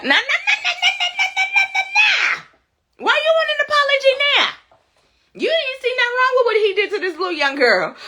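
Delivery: high singsong nah-nah, then a fast high jab; mocking nah. Real, not acted. Streamer mocking the dad in chat, high singsong jeer. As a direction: tease him in a high mocking chant, laughing. Mocking the father's double standard.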